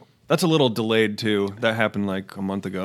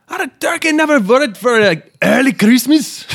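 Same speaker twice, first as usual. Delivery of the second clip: slow, loud, high mock-Irish accent; hammed-up Irish accent that misses the mark; bad Irish accent; 8 dB louder than usual. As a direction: badly fake an Irish accent, drawn out and shouted high. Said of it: "I don't even think that's an accent".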